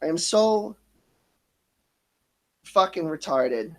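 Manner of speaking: real emotion welling up, words breaking off; fighting tears. Real, not acted. Vlogger breaking down telling viewers about his past, fighting tears. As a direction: voice catching, pausing to compose himself. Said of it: "give me a minute. I need to compose myself".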